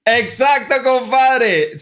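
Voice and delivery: high, loud burst of excitement; thrilled cheer; excited. Real, not acted. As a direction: shout the confirmation with joy. Guessed origin, excited confirmation.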